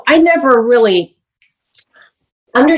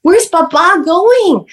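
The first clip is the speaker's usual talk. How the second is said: put-on child asking for Baba; 8 semitones higher, 3 dB louder, much slower than usual. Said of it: "It's actually Baba".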